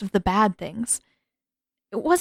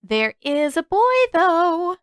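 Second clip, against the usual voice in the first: higher, lilting singsong tease; sing-song teasing; 9 semitones higher, 5 dB louder, slower than usual. Acted. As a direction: sing it out to needle her. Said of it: "Krisock sing-songed".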